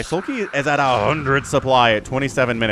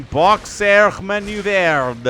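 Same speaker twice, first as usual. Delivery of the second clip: mock French flourish on the move's name; French accent; 5 semitones higher, slower than usual. Caster naming a game move in mock French accent. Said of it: "say that with a French accent".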